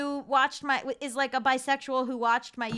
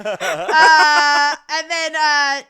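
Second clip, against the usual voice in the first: laughing; 2 semitones higher, 14 dB louder, much slower than usual. Real, not acted. Laughing fit after confession.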